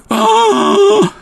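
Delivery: sharp high theatrical gasp; a hammed-up shocked intake of breath; mock gasp. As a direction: gasp as if scandalised. Mock shocked reaction to rhubarb.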